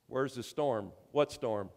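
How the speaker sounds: normal talking voice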